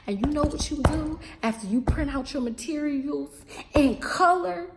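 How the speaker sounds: usual speaking voice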